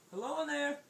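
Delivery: high, singsong hello; loud, high-pitched storybook call. Acted. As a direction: call into a hiding place. Swordfish calls into the shells.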